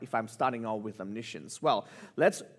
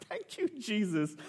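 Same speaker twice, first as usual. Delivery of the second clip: acted high, softer tearful thanks; tearful gratitude; 7 semitones higher, 5 dB quieter than usual. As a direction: imitate being moved to grateful tears. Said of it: "And I'm in tears".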